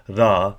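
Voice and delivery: "ra" with the pitch rising on the a. Shown as a demonstration. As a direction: lift the tone at the end of "ra". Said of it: "increase in tone at the last A".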